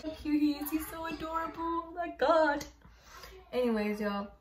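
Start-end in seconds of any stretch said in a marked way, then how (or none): 0.0-2.6 high, doting whine; adoring coo. Real, not acted. Gushing to viewers that Simba is adorable, high coo. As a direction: coo helplessly, high and drawn toward the end.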